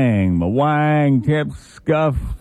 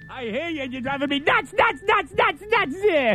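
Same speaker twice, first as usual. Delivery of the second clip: shrill panicky whine, much higher; losing it, higher and higher; frantic high voice; 13 semitones higher, 5 dB quieter than usual. Acted. Frantic man shrieks at monster.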